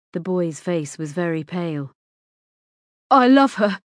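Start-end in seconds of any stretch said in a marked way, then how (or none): 3.1-3.8 loud, higher blurted confession; sudden outburst. A narrator's reading. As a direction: blurt it out with feeling. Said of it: "James's words spilt out suddenly".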